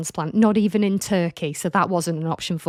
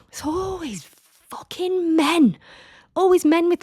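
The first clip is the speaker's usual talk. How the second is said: exasperated; 9 semitones higher than usual. Real, not acted. Host venting to listeners about rude men, exasperated and high. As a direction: pitch up in fed-up disbelief.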